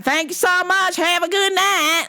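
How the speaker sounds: very high, singsong farewell; hammed-up high waitress voice. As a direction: imitate a perky server signing off. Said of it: "she's like".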